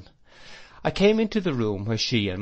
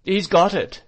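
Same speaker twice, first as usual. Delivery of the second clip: high, loud outcry; alarmed cry; 9 semitones higher, 7 dB louder than usual. A narrator's reading. Miss Murdstone sees boy holding baby, crying out. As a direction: cry out in horror. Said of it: "cried Miss Murdstone".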